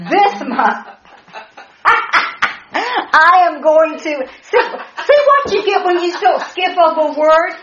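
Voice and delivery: high, laughing, overflowing with joy; joyful laughter breaking through the preaching; laughing excitement. Real, not acted. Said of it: "I'm getting excited".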